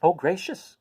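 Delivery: surprised cry. A narrator's reading. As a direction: gasp it out in surprise. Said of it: "cried Dorothy".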